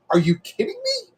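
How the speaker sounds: high-pitched disbelief; retold squeaky outrage; incredulous protest. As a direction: let the question shoot up high. Retelling protest to wife.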